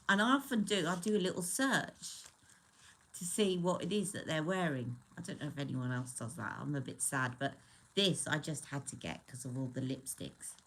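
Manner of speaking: usual speaking voice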